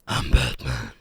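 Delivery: deep, low growled Batman voice. Pretend. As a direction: growl it like the Dark Knight. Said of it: "I like to do the Batman thingy".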